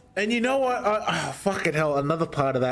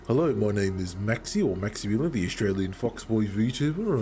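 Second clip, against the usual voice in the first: lower-pitched deep streamer intro; really deep voice; 4 semitones lower than usual. Shown as a demonstration. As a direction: introduce yourself in a deep put-on voice. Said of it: "a really deep voices".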